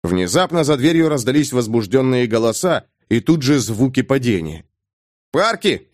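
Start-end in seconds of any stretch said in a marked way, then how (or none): 5.3-5.9 high call across the room. Acted. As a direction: call to the sailors. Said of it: "Смирт окликнул".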